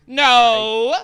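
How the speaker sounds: lightly voiced accuser, rising to a loud no; agitated creature. Game master as kenku denouncing the most wanted wretch, agitated. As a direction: denounce someone, bursting out at the end.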